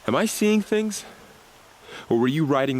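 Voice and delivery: high-pitched, startled disbelief; can't believe his eyes, voice jumps up; surprised. Acted. Guard sees her ride a Strider.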